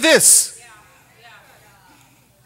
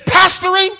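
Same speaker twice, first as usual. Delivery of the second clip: incredulous boss; 6 semitones higher than usual. Retold or imitated. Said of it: "He said".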